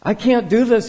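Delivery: high, loud vehement protest. A narrator's reading. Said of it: "he said louder and more vehemently".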